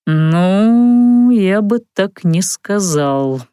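slow drawn-out doubtful reply; drawled. Acted. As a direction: stretch the words out lazily. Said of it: "протянул Локи".

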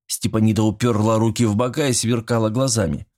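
plain reading voice

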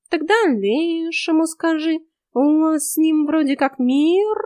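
high, slow lazy cat voice; snooty bored cat. Acted. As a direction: drawl it like a smug cat. Cat voice in the story. (высокий ленивый кошачий голос)

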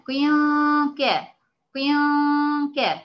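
قيان as qin, held long and high before ك; loud sustained nasal hum in قيان, short ك after; tanween with kasra. Shown as a demonstration. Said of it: "لما أجي أقولها".

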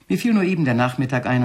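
usual speaking voice

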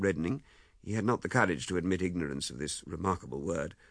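ordinary delivery

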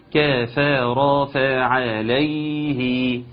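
كفر فعليه chanted with every fatha complete; full fathas. Shown as a demonstration. Teacher modelling the full vowels, chanted. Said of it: "تاخد بالك من فتحة الكاف".

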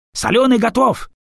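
high, loud alarmed yell. Acted. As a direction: shout it out across the gunfire. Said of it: "орёт в бойницу якорь".

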